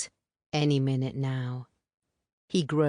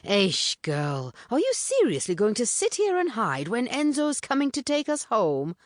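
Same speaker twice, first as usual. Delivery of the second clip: put-on higher, disbelieving teasing girl; half scold, half tease, pitched up; incredulous Puleng; 8 semitones higher than usual. A narrator's reading.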